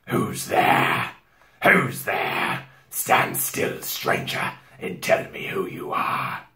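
slow, breathy growl with little tone; growling wolf. A narrator's reading. Wolf challenging a stranger at the door, growling. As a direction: growl it out like a guarding beast. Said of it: "said in a growling voice".

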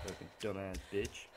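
ordinary talk, nothing marked

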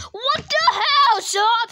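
loud, high, drawn-out outburst; outraged yelling. Pretend. Sonic's friend told him to stop, outraged yelling. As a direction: yell it in play-acted outrage.

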